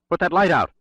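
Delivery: high, loud urgent command; cried out. Acted. Bray yells to the Russian to douse the flashlight, loud high cry. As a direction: cry it out so he kills the light now. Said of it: "cried Bray".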